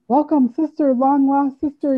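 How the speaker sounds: loud, high, drawn-out greeting; imitated exuberant welcome; effusive welcome. Voicing people who greet her.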